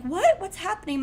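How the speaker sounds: high, loud frightened cry; retold panic, voice jumps high; panicked. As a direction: panic as you're pinned down. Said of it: "sitting there like".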